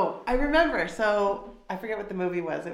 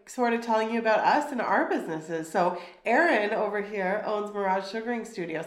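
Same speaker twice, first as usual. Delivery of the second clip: ordinary talk, nothing marked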